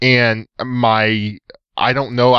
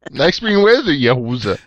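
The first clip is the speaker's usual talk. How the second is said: hammed-up Canadian accent, East Texan trying; a clumsy stab at a Canadian accent; bad Canadian accent; 2 semitones higher than usual. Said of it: "That was terrible".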